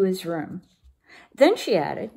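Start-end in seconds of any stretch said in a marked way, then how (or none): none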